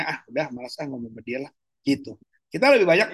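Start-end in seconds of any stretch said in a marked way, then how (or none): 0.0-1.5 fed-up grumble. Retold or imitated. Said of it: "Kita jadinya kayaknya".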